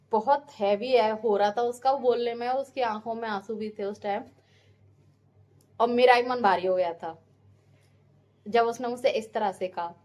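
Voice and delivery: ordinary delivery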